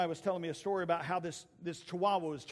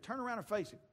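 higher-pitched recap at normal volume; recalled mama's advice; 6 semitones higher than usual. Retold or imitated. Boy remembers what his mama said, lightly quoted. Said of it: "remembered what his mama said".

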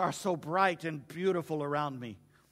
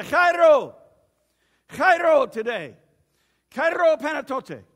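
chairo called out much higher and louder; shouted greeting; 7 semitones higher, 11 dB louder, slower than usual. Retold or imitated. People greeting each other by shouting chairo. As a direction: shout joy across to a friend. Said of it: "they would shout it to one another".

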